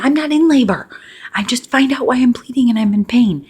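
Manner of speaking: high, loud, panicked denial; retold panic at the hospital, high and loud; freaking out. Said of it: "I'm freaking out".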